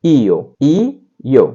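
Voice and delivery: ईओ stretched slowly, ई then ओ; repeat-after-me drill; slow model word. Shown as a demonstration. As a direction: draw out ईओ for students. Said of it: "उच्चारण इसका होगा".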